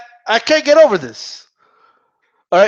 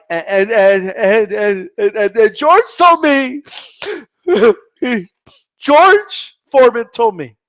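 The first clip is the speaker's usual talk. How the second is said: hammed-up tearful stammer while retelling; Jim Lampley; 4 dB louder than usual. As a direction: imitate an announcer losing control to emotion. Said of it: "That makes you emotional?".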